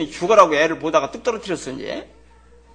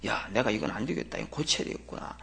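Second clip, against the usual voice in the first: quiet self-talk; 7 semitones lower, 9 dB quieter than usual. Retold or imitated. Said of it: "살펴보고".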